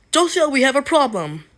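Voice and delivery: urgent character voice. Acted. Mister Hobson alerts Josiah to a problem, urgent character voice. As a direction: call out the alarm, high and loud.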